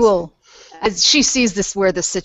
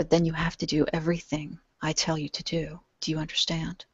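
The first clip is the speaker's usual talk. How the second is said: whispering; 9 dB quieter than usual. Acted. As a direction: speak softly, close to his ear. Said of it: "and whisper".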